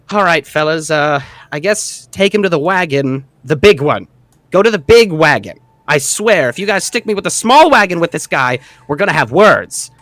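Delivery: a small boss threatening his guards; bossy gnome. Acted. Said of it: "and just says".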